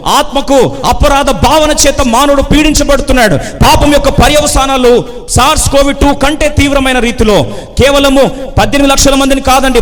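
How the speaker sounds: high, loud, impassioned sermon cry; full-force preaching. Acted. Preacher challenging people laughing outside but crying inside, full force. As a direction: confront the congregation with ringing force.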